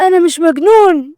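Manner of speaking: screaming protest. A narrator's reading. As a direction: shout it in hysterical denial. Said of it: "هو فضل يصرخ فيهم". (صريخ باعتراض)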